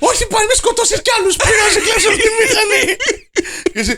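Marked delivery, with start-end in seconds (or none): 0.1-2.8 much higher, laughing, crying shout begging Michalis; a hysterical woman pleading for mercy; hammed-up wife screaming at her husband. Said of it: "Άσε μη, γυναίκα!".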